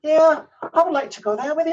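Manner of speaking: quick, high Northern Irish lilt; reply in a melodic Northern Irish accent; fast sing-song Northern Irish imitation. Shown as a demonstration. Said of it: "The accent there is very melodic".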